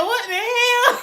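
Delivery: high shriek of what the hell; shocked shout. Retold or imitated. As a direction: caught off guard by a card, yelling. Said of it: "Spicy said".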